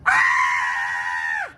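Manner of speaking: short loud yelp of fright; alarmed cry. Acted. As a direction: yelp like something just landed on you. Alarm at bird on head.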